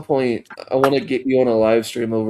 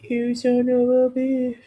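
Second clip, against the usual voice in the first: mock outrage; 13 semitones higher, slower than usual. Mock insult to friend Kev.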